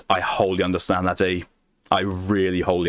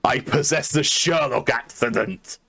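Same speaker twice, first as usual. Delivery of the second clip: slow, higher hammed-up Sherlock Holmes accent; playful Sherlock Holmes accent, drawn out; 4 semitones higher, slower than usual. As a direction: declare it grandly as a mock Sherlock Holmes. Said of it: "reached her accent".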